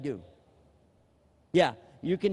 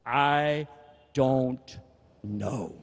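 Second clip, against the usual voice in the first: "I", "don't", "know" pried out one word at a time with pauses; each of the three words dragged out separately; halting "I don't know"; 4 semitones lower, much slower than usual. Shown as a demonstration. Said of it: "we can't say the three words".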